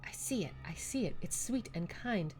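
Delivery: soft, hushed character voice; gentle game character. Acted. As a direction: quietly describe something sweet and kind.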